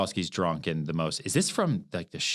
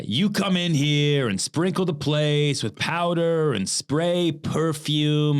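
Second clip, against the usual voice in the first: put-on slow, drawn-out drunken Stanley, pitched up; slurred, mocking drunk Stanley with higher pitch; 7 semitones higher, 4 dB louder, much slower than usual. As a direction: stretch the words like a drunk brute sneering. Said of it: "and Stanley says".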